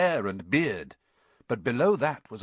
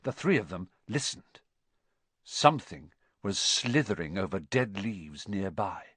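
usual speaking voice

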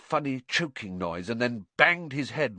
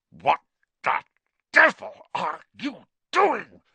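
louder, angry uncle through gritted teeth; 7 semitones higher, 6 dB louder than usual. A narrator's reading. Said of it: "through gritted teeth".

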